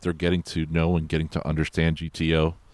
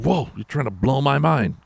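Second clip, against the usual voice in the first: mock amazement; 9 semitones higher, 3 dB louder than usual. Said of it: "G T O's like".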